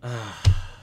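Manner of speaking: long weary exhale; heavy acted sigh; exasperated sigh. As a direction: sigh as a fed-up friend. [name] sighs before replying.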